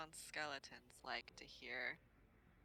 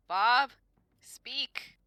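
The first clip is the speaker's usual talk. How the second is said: sharp loud command, pitched much higher; commanding call; 9 semitones higher, 16 dB louder than usual. Acted. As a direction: order the dog loudly to speak. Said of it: "I wonder if Bob can speak to them".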